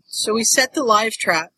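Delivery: ordinary talk, nothing marked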